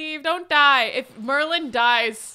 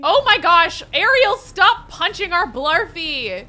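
loud, slow scolding at the bully; scolding shout; 4 semitones higher, 10 dB louder than usual. Real, not acted. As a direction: call out sharply to make her stop. Scolding sim punching pet.

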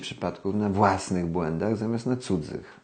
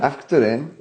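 normal talking voice

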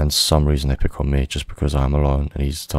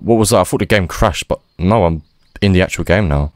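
ordinary delivery

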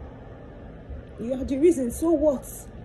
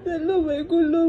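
crying; 4 semitones higher than usual. Real, not acted. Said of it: "Who are you crying for?".

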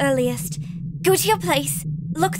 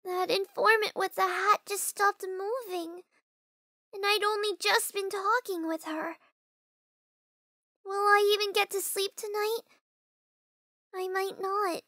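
soft, higher worried murmur; uneasy musing; 5 semitones higher, 6 dB quieter than usual. Acted. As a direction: muse fearfully to yourself. Alone at night, uneasy.

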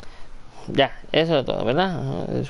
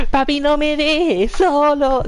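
high, slow mock-baby plea; voz de bebé suplicante; 12 semitones higher, 4 dB louder, slower than usual. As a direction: play a baby begging daddy, high and drawn out. Voices the crying baby. (súplica fingida de bebé, aguda y lenta)

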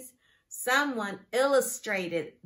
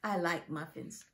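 ordinary talk, nothing marked

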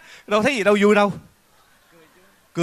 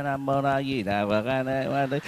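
mock prayer; 6 dB quieter than usual. Host mock prays, exhausted.

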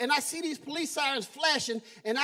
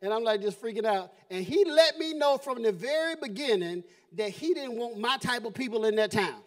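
usual speaking voice

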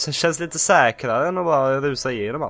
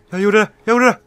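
higher and louder joyful shout; triumphant cheer; 8 semitones higher, 8 dB louder than usual. Real, not acted. Gamer making it through, triumphant cheering. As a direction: celebrate the escape. Celebrating escape.

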